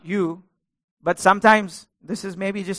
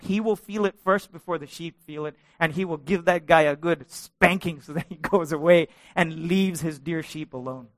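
normal talking voice

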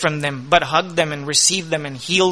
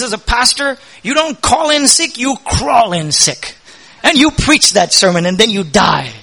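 stern professor scolding; 4 semitones higher, 6 dB louder than usual. Retold or imitated. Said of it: "That's how he used to speak".

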